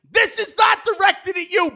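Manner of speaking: shouting at the clerk mid-meltdown; shouted apology. Retold or imitated. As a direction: yell that it isn't personal. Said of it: "And I was like".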